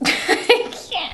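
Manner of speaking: high, breaking up laughing; can't speak for laughing; helpless real laughter. Real, not acted. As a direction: laugh so hard the words barely come. Cracking up at the tight dress.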